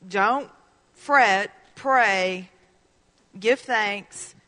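"Don't", "fret", "pray", "give thanks" each punched loud, spaced apart; emphatic scripture list. Shown as a demonstration. Pastor drilling the verse into the congregation, each word punched. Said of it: "Because it says".

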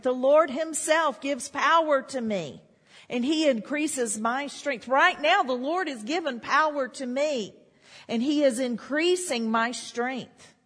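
a rising, fervent declaration; preaching at full force. Acted. Preacher declares God's strength to his congregation, fervent. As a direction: build it up like a triumphant testimony.

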